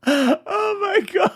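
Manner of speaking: very high, slow, laughed-out words; helpless laughter. Real, not acted. Host reacts to a cheeky comment, laughing helplessly. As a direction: gasp it out through real laughter.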